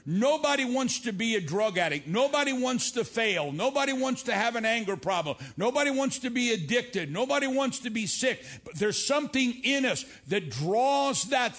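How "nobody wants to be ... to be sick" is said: loud, high, pounding preacher's cadence; preaching. Real, not acted. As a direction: hammer each line home to the crowd. Preaching at full force.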